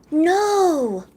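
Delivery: gasp, then a high, loud cry; long, high "no" of disbelief; shocked dismay. Real, not acted. Streamer reading sad news about Zephyr, shocked dismay.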